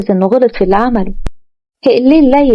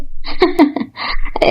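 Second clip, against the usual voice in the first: short breathy laugh; a light airy chuckle; soft amused laugh; 7 semitones higher, 5 dB quieter than usual. A narrator's reading. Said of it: "ضحكت مروة".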